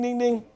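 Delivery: high, sung ding ding like a door bell; dog ringing the door bell; imitated bell ringing. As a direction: chime it out way up high. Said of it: "the dog goes ding".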